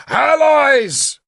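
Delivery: high, ringing shout; excited shout. Acted. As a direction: shout it out with glee. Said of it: "he shouted again".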